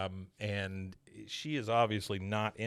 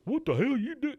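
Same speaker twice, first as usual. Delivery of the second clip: higher mocking echo of Hank; 13 semitones higher, 3 dB louder than usual. [name] mimics Hank's outburst, higher teasing echo. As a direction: throw his words back at him. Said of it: "making fun of Hank".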